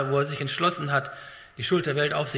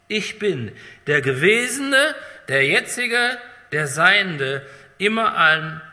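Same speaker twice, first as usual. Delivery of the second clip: higher, louder, slow and solemn; proclaimed; 4 semitones higher, 6 dB louder, slower than usual. Acted. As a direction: announce it as God's own voice. God's voice proclaimed in sermon.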